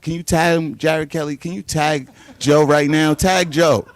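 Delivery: loud, high calls to the room; yelling out. Real, not acted. Fighter yelling at the crowd to tag his rival, loud. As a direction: shout it across the press room.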